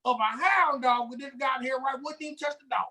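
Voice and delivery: high, hammed-up boastful gun nut; gun bragger. Mocking gun nuts to a friend, hammed-up boasting. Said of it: "That's what they say".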